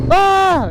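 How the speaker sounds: high imitated "ah" cry; groundhog cry imitation. Vlogger asking if these groundhogs make that cry, high imitation. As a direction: mimic the groundhog's high yelp. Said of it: "Are they the ones that go".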